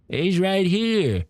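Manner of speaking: high, drawn-out character voice; old shopkeeper character. Acted. Storekeeper grumbling to a customer about the beaches, high character voice.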